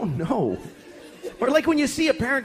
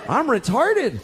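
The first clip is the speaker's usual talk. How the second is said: shocked realization; 6 semitones higher, much slower than usual. Retold or imitated. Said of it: "I looked around and I realized".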